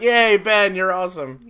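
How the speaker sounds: bright mock cheer, high. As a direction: cheer him on. Cheering Ben, retold.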